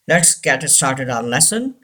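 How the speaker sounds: ordinary delivery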